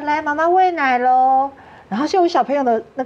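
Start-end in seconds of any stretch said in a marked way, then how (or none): 0.0-1.5 imitating a nurse. Mother recalls nurse calling mothers to breastfeed, mild sing-song. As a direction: lightly sing out the feeding call like a ward nurse. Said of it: "你就会听到有护士说".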